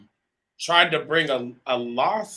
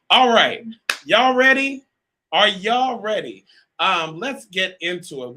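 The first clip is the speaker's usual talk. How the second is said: higher, louder hype-up to the crowd; hype call; 6 semitones higher, 8 dB louder than usual. Real, not acted. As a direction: pump up the live chat. Host hyping the live audience.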